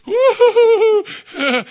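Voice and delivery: slow, high, loud woo-hoo laugh; giddy whooping laugh. Retold or imitated. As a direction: whoop like a shaken snow globe. Mimics being turned upside down.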